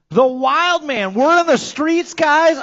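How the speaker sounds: big high, loud stretched-out build-up; hyped announcer call. Real, not acted. Announcer teasing the crowd about the Wild Man, hyped and loud. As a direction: hype the crowd over the rumour.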